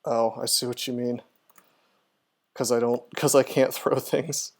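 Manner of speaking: ordinary delivery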